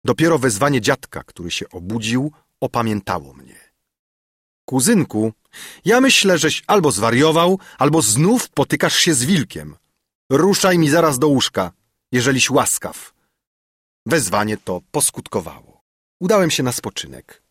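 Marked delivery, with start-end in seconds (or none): 4.6-13.1 raised, louder scolding; higher-pitched reprimand from an elder; scolding grandfather. A narrator's reading. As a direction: order him off to bed in exasperation. Grandfather scolds nephew awake.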